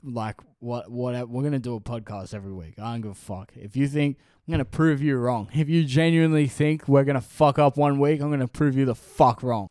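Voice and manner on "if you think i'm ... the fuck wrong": defiant. Real, not acted. Defiant vow to viewers.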